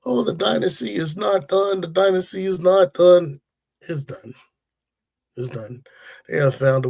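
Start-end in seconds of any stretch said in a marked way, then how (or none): 0.0-3.4 high-pitched mimicry of deluded fans; mocking bandwagoners. Host imitating Kansas City bandwagoners insisting, mocking high voice. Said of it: "Some people are Kansas City bandwagoners". 3.8-5.8 quiet dismissal. Real, not acted. Host answering the bandwagoners, quietly dismissive.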